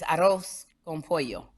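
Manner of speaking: arroz con pollo with tapped R and Spanish vowels, x0.7; Spanish pronunciation. Shown as a demonstration. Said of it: "Just say the stuff the right way".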